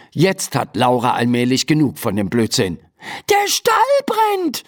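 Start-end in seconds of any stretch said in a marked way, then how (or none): 3.3-4.6 high, drawn-out exclamation; exasperated calling out. Acted. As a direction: insist on it slowly, pitched way up. Fed up, repeats the alarm. (hoher, entnervter Ausruf)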